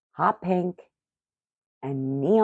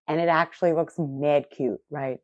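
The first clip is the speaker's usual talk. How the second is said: ordinary delivery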